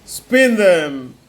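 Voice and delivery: slow, loud royal command; a slow, weighty king's command; stern king. A narrator's reading. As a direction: order her like a stern king. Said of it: "said the king".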